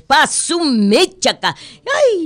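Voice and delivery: high, loud slow exclamation; excited exclamation. Real, not acted. Host exclaiming to listeners, excited. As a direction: blurt it out in delight.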